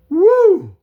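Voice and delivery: very high, loud whoop; cheer. Real, not acted. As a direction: let out a big celebratory whoop. Cheer celebrating the show.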